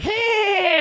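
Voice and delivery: very high drawn-out witchy screech; imitated witch cackle. Storyteller mimics the lady across the restaurant, witch cackle. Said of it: "a little witch cackle".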